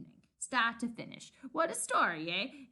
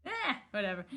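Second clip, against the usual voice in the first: ordinary talk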